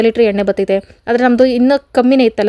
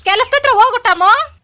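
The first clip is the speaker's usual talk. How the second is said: much higher and slow, coaxing the dog; talking to pet; 12 semitones higher, slower than usual. Real, not acted. Sending her dog off to work, high slow pet voice. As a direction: stretch it out sweetly, way up high.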